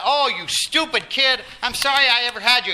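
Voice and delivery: loud exasperated parent yell; angry outburst. Retold or imitated. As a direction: snap at your son in a flash of temper. Parent lashing out at son.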